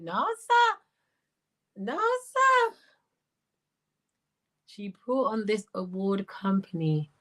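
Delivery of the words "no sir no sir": very high squealed refusal; scandalised squeal. Real, not acted. Host reacts to a rude comment, high squealed refusal.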